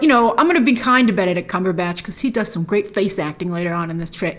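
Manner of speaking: usual speaking voice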